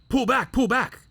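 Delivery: high-pitched mock cockpit shout; urgent command. Instructor to trainee near the landing strip, urgent. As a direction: imitate an instructor urgently ordering.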